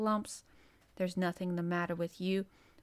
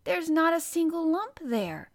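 high, louder, insistent declaration; emphatic verdict; 8 semitones higher, 6 dB louder than usual. A narrator's reading. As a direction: pronounce it with total certainty. Child gives firm verdict.

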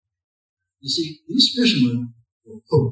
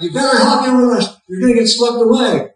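raised, higher shout from the boat; shouting from rowboat; 7 semitones higher, 5 dB louder than usual. Retold or imitated. Boat rescuers shouting to man on roof, urgent raised call. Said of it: "people shout".

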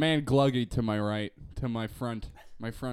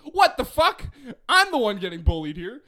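high, loud mock outrage; exaggerated offended outburst; indignant yell; 10 semitones higher, 9 dB louder than usual. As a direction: protest like you're the victim. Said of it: "And I was just like".

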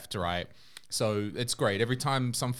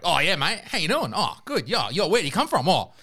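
higher, louder hammed-up Australian backpacker; Australian accent; 5 semitones higher, 5 dB louder than usual. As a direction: chat her up as a cheery Aussie bloke. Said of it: "some Australian guy there going, like".

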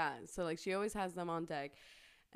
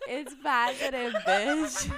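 loud, slow, drawn-out reveal; mock announcement; 5 semitones higher, 12 dB louder, much slower than usual. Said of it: "I walk out like".

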